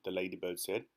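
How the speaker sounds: plain reading voice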